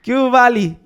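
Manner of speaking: mock Michoacan accent. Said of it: "Talk how they talk".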